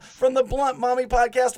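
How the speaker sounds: normal talking voice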